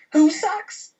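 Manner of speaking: very high, incredulous comeback; high-pitched mock outrage; indignant challenge. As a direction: act out squaring up to a heckler. Retold challenge to the heckler.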